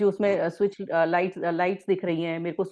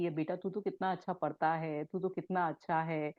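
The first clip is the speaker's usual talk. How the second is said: put-on softer, gentle praise for a child; loving praise to a child; 8 dB quieter than usual. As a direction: coo it softly like praising your kid. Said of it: "बच्चे से बोले कि".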